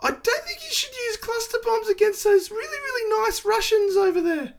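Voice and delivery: put-on timid little-kid falsetto, very high; squeaky child tugging at a grown-up for attention; nine-year-old Chris Hipkins. Prime minister waddling up to a president like a nine-year-old, squeaky child voice. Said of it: "does look like a fucking nine-year-old".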